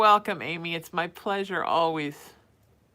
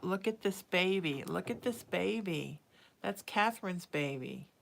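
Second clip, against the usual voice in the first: ordinary delivery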